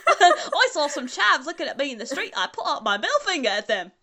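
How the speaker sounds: put-on laughing through a silly British accent; mock British accent. British version of My Immortal, mock British accent. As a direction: narrate like an absurd Brit in a fake British accent. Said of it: "I feel like I'm the British version of".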